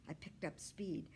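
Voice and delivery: usual speaking voice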